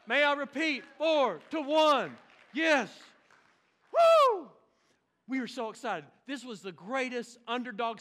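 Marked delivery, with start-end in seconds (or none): 0.1-2.9 full-voiced shout, slow and emphatic. Real, not acted. Preacher shouting a game score. 3.9-4.5 high, loud victory whoop; excited whoop. Real, not acted. Cheering over the big win.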